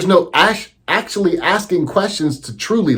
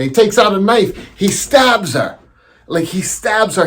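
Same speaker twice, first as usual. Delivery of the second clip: usual speaking voice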